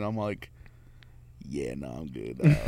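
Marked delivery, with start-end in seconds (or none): 1.5-2.6 low, backing-off dismissal; polite refusal. Retold or imitated. Driver turning down the shop owner's offer, low and dismissive.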